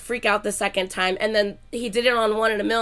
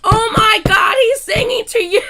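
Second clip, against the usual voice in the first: high, loud excited cry; excited squeal; 12 semitones higher, 11 dB louder than usual. Retold or imitated. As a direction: squeal it out like a thrilled fan. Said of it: "you go".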